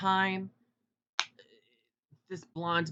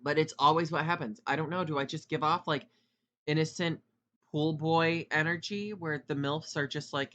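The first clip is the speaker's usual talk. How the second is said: ordinary talk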